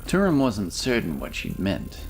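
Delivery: usual speaking voice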